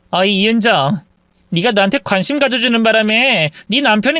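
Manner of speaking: put-on smug, taunting young woman; gloating mistress. Mistress taunting wife she now owns him, smug. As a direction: savour the win.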